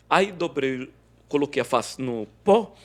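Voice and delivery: ordinary talk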